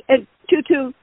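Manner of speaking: ordinary talk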